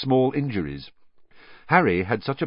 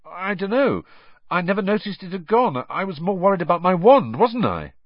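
offhand Harry; 11 semitones higher, 5 dB louder than usual. A narrator's reading. Harry offhand, voiced higher.

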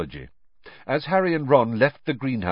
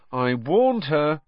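slow, drawn-out I warned her; slow grim remark; 6 semitones higher, slower than usual. Acted. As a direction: say it heavily, letting each word sink. Ron says it twice.

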